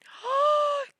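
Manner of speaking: loud, high-pitched gasp; sharp squeaky gasp of delight. Real, not acted. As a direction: gasp at something adorable. Reacting to a cute item.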